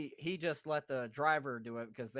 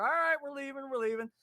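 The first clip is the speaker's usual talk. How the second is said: raised, higher voice giving in; retold hasty placating; 10 semitones higher, 4 dB louder than usual. Buddy placates the angry guard, hasty retelling. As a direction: imitate a buddy backing off.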